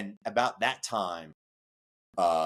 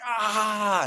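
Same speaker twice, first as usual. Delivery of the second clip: high pained scream; 13 semitones higher, 5 dB louder than usual. Retold or imitated. Smith kicking and screaming.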